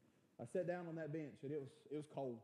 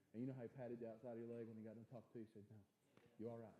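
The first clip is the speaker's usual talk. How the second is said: near tears, low and much softer; tearful; 7 semitones lower, 8 dB quieter, faster than usual. Real, not acted. Grieving son recalling his father's death, quiet and tearful. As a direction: hold back tears as you tell it. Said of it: "I start crying again".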